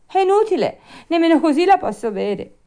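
high, loud whining excuse; Pinocchio, peevish. Acted. Puppet makes excuses about the pillow, peevish. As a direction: complain shrilly like a difficult child.

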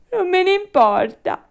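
high, loud bratty retort; Pinocchio, defiant. Acted. Puppet brushes off the fairy's warning, defiant. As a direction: throw it back stubbornly.

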